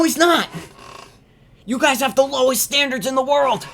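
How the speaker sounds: high, strained voice calling out the crowd; outraged rant. Real, not acted. Streamer ranting at Todd's fans, high and indignant. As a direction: rail at everyone fooled by him.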